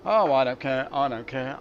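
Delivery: usual speaking voice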